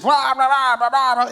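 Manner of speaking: mock babble of many voices; jumbled voices. Preacher imitating all the voices competing with God, jumbled babble. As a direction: babble it in one steady run. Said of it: "we just have all these voices".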